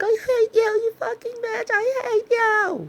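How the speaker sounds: very high mock shriek; imitated screaming girl. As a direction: screech it in falsetto, dragging out the end. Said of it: "was this girl screaming".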